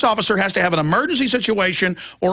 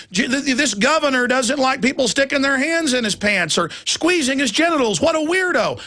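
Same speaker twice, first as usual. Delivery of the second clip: mocking sneer; 7 semitones higher than usual. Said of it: "mocking the jeering critics".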